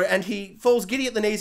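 normal talking voice

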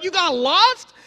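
high, rising disbelieving question; incredulous. Retold or imitated. Speaker to couple who got lost, incredulous. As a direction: repeat it back in astonishment.

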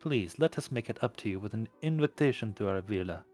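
put-on velvety, lightly amused Russian accent. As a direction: purr it in a soft Russian accent. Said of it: "the Russian accent amused".

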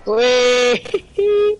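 high, loud robotic squeal; voice changer. Real, not acted. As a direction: squeal it through a robot voice filter. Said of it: "your voice is a robot".

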